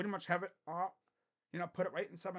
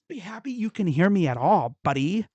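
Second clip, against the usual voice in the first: much louder, telling the viewer off; loud scolding; 16 dB louder than usual. Real, not acted.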